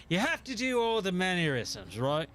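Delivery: rakish, rum-soaked pirate voice; Jack Sparrow. Shown as a demonstration. Streamer explaining the mannerisms of his impression, Jack Sparrow voice. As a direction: slip into a swaggering movie pirate. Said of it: "when you do a Jack Sparrow impression".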